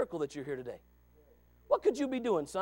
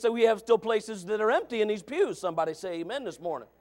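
ordinary delivery

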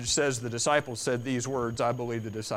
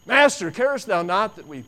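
raised plea; 6 semitones higher, 10 dB louder than usual. Acted. Quoting disciples again.

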